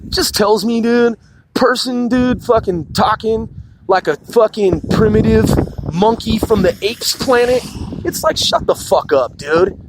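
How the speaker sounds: ordinary talk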